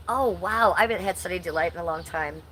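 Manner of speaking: usual speaking voice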